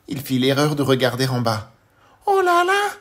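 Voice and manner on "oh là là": very high, loud little yelp of fright; frightened cry. Acted. Tiny man on the wall, high loud alarmed cry. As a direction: cry out as he looks down. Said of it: "s'écria-t-il".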